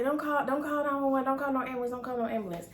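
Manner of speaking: high-pitched, hurried protest; urgent pleading. Retold or imitated. Daughter on the floor stops mom calling, urgent high plea. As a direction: plead to stop the call. Begs mom not to call ambulance.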